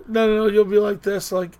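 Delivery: ordinary talk, nothing marked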